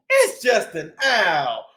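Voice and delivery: plain reading voice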